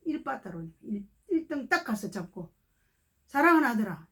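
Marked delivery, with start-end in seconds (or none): 3.3-4.1 louder, tender call to a beloved son; tender blessing. Retold or imitated. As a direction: speak it as a loving blessing. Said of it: "제 입에서요".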